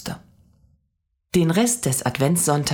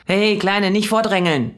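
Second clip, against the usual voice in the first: louder, higher scolding stranger; 7 semitones higher, 4 dB louder than usual. A narrator's reading. Stranger in the queue scolding Mia, louder. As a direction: tell the kid off sharply. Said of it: "schimpfte jemand".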